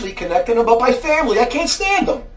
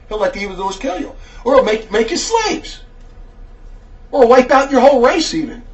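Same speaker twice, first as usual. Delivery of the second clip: normal talking voice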